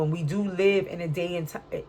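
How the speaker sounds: usual speaking voice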